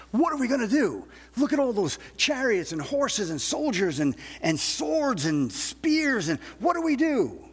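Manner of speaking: a frightened servant seeing the army, voice climbing; terrified servant. Retold or imitated. Servant to Elisha, seeing the surrounding army: panicked high cry. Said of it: "is terrified".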